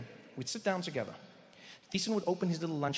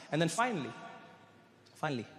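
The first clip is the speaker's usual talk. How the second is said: normal talking voice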